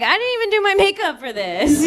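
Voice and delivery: flustered high complaint; high excited whine. Retold or imitated. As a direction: squeal it in dismay. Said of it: "I'm like".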